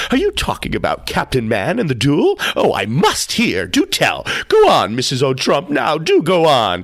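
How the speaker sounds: high, loud, eager interjection; little interloper screaming across the room; excited feminine scream. Acted. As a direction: pipe up loudly and beg for the tale. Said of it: "here screamed some little feminine interloper".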